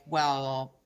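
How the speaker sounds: low, offhand mock dig; nonchalant passive-aggression. Shown as a demonstration. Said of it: "something that's really nonchalantly said".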